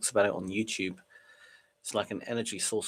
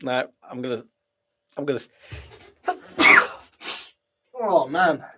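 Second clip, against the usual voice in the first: sneezing mid-sentence; breaking off for a sneeze; about to sneeze; 3 semitones higher than usual. Real, not acted. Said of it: "I had to sneeze".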